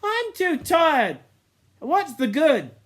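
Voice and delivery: high, slow, hopeless whine; despairing, worn out. A narrator's reading. Eric flung on the sand tells Ralph, hopeless. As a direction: give up out loud.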